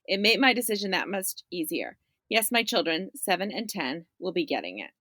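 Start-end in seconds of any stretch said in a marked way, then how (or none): none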